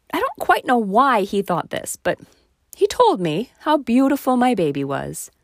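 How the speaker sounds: ordinary talk, nothing marked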